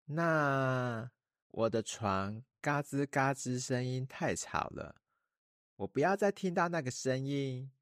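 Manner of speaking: put-on high, childlike girl voice; a small princess making a wish; little princess voice. A narrator's reading. Princess makes her wish.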